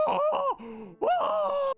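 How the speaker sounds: high, loud imitated scream; mock scream. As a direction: scream as if being strapped down. Todd screams while tied down.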